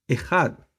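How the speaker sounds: "Ejad" with the Hebrew throaty j, stress on the end; Hebrew pronunciation demo. Shown as a demonstration. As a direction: demonstrate "Ejad" carefully, as a word on its own. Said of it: "Así se pronuncia".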